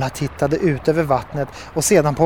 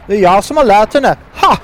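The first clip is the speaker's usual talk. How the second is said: loud, raised-pitch toad boast; proud boast; 5 semitones higher, 6 dB louder than usual. A narrator's reading. Herr Buffo claiming he taught Nova, proud boast. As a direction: crow about it, loud and higher. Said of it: "ropade herr Buffo stolt".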